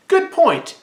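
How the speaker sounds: quick high Professor X quip; Professor X's reply. Retold or imitated. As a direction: snap it out lightly as Professor X. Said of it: "Professor X is like".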